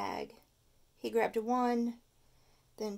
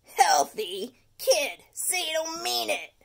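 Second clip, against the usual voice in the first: louder, hammed-up wail; mock wail; 6 semitones higher, 6 dB louder than usual. The tooth wailing at Stuart's healthy diet. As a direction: plead in horror. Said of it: "wailed the tooth".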